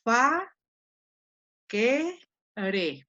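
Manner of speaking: "Fa, que, re" with a pause after each syllable. Shown as a demonstration. Teacher dividing facere for the class, syllable by syllable. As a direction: split "Fa, que, re" into separate beats. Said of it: "Siguiente división silábica".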